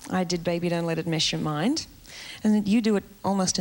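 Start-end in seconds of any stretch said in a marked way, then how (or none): none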